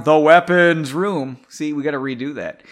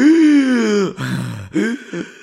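sharp winded gasp, higher and louder; gasp for air; 6 semitones higher, 7 dB louder than usual. Acted. Henry winded by a spell, gasping for breath. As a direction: suck in air after a blow to the chest. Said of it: "trying to catch his breath".

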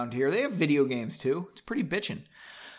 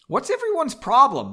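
high, louder annoyed question; angry; 9 semitones higher, 6 dB louder than usual. A narrator's reading. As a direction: push it up high, irritated. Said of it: "now angry".

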